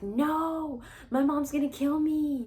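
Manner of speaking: high-pitched mock panic; retold scared protest. Granddaughter begs grandma not to call mom, high retold panic. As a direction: protest in a high, pleading voice, acting it out. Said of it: "I'm so scared".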